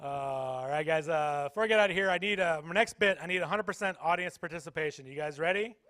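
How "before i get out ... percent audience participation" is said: normal talking voice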